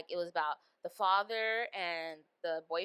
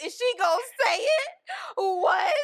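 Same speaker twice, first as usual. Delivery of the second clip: high, loud squeal of shock; shrieking disbelief; 10 dB louder than usual. Retold or imitated. Retelling her reaction to the movie, a loud high shriek. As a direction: shriek it like you can't believe it. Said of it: "I was rolling".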